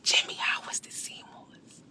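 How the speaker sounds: slow whispered tease of Jimmy. Real, not acted. Creator teasing Jimmy on live, slow whisper. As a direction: draw it out under your breath.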